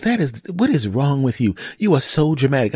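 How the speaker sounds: exasperated wife, imitated. Voices exasperated wife scolding.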